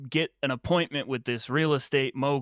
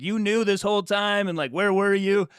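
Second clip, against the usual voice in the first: hurt accusation; 8 semitones higher, 5 dB louder than usual. Retold or imitated. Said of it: "And he's like".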